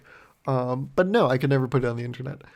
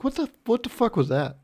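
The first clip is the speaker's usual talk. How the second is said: a raised, baffled what-the-fuck; baffled outburst; 9 semitones higher than usual. Retold or imitated. Retelling the drive-thru order to viewers, stunned and baffled. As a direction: replay your stunned reaction in the car. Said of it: "But I was like".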